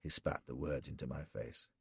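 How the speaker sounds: usual speaking voice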